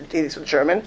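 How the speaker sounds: ordinary delivery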